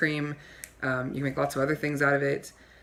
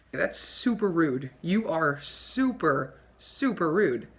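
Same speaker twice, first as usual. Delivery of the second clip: ordinary talk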